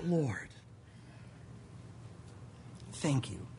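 low, soft, with long pauses; hushed thanks. Retold or imitated. Sitting alone thanking the Lord after they walk off, hushed. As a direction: murmur a relieved prayer to yourself. Private prayer after narrow escape.

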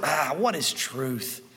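low, soft, drawn-out musing; slow dismissive sigh into the question; Pontius Pilate. Acted. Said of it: "Pontius Pilate standing in front of the truth".

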